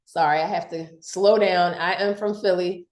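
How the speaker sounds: usual speaking voice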